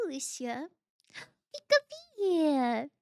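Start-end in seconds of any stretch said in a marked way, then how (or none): 1.1-2.9 slow, high squeaky critter call; cute creature squeak. Pretend.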